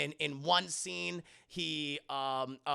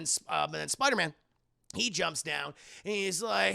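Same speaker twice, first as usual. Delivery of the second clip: ordinary talk, nothing marked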